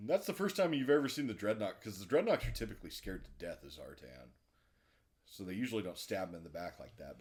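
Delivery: normal talking voice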